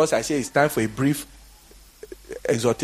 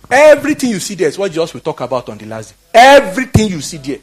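fiery preaching; 4 semitones higher, 8 dB louder than usual. Real, not acted. Preaching climax.

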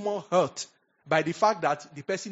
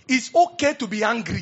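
louder, higher emphatic declaration; emphatic preaching; 5 semitones higher, 7 dB louder than usual. Real, not acted. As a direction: drive the point home to the congregation.

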